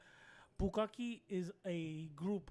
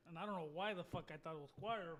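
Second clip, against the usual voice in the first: ordinary delivery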